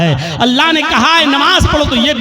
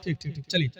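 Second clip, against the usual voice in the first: a much softer, lower, subdued reply; hushed caliph; 9 semitones lower, 15 dB quieter, much faster than usual. Retold or imitated. Preacher voicing caliph Umar's quiet, softer reply. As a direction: speak it low and quiet, as if moved. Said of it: "حضرت عمر نے کہا".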